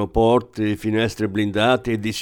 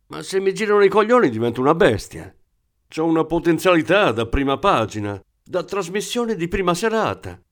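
heated rant; 7 semitones higher than usual. Acted. Thief rants, threatening.

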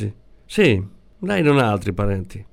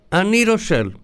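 called out higher, over a bad phone line; shouted; 10 semitones higher than usual. A narrator's reading. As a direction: raise the voice to carry down the line. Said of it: "gridò il poliziotto".

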